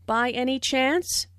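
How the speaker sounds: "By any chance" climbing to the end; checking something, "chance" pitched upward; rising intonation. Shown as a demonstration. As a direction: lift "chance" as in a yes-no question. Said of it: "Notice the rising intonation".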